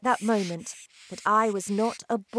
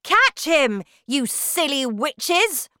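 high, loud witch's order; angry shout; 6 semitones higher, 6 dB louder than usual. A narrator's reading. Grand High Witch scolds slow witches, loud shout. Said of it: "shouted the Grand High Witch".

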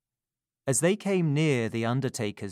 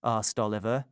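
ordinary delivery

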